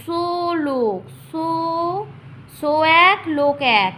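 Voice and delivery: long held, sustained syllables; broken into syllables. Shown as a demonstration. Teacher splitting verse for counting, slow sustained syllables. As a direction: hold each syllable like a note. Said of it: "প্রথমে ভেঙে নেবে".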